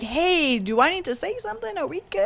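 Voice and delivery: soft, high, hesitant little voice; tentative check-in. Retold or imitated. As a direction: ask gingerly, high and small. Acting out his tentative check-in.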